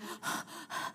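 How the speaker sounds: unvoiced puffs of exertion; heavy winded breaths, acted out; labored huffing. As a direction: huff as if pedaling uphill. Gasping up the hill on bike.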